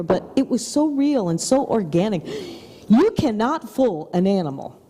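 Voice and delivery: normal talking voice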